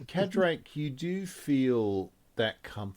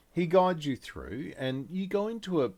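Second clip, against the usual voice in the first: usual speaking voice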